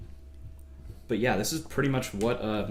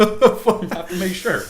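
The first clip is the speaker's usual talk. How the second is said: slow, loud words through laughter; laughing; 9 semitones higher, 11 dB louder, slower than usual. Real, not acted. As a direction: laugh while saying it. Laughing while tasting.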